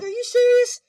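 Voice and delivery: very high, loud squeal of disbelief; incredulous. Retold or imitated. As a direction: shriek it in total disbelief. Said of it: "and he's like".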